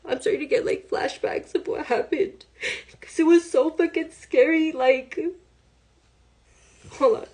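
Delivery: rising, breaking voice near tears; fighting back tears as the memory returns. Real, not acted. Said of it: "I'm still having flashbacks".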